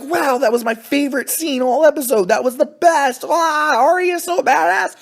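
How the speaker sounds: a hammed-up fan swooning over the scene; mocking gushing fan. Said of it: "People are, like, screaming, getting excited".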